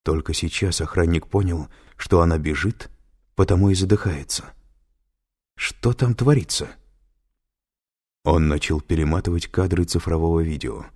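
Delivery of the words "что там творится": plain reading voice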